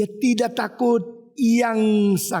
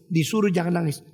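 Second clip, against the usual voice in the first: ordinary delivery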